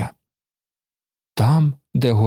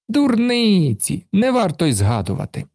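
carefree brush-off; 7 semitones higher than usual. A narrator's reading. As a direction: shrug it off carelessly. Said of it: "безжурно махнув". (безжурно, легковажно)

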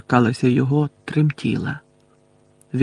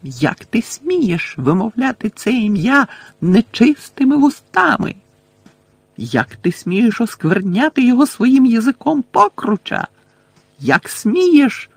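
high-pitched outrage, stretched words; scandalized, high-voiced scolding; outraged cry; 9 semitones higher than usual. A narrator's reading. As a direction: shriek in offended fury. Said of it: "крикнула Белатриса".

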